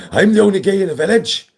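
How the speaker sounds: quoted catchphrase. Retold or imitated. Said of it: "I remember that".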